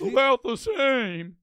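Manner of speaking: high, drawn-out, sad and nervous cartoon voice; Mister Mackey. Streamer parroting the played clip, exaggerated nervous impression. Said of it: "like, sad and nervous all the time".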